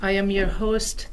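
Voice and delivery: ordinary talk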